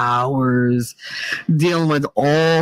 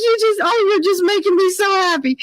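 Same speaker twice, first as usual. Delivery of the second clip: put-on gushing old lady; 14 semitones higher than usual.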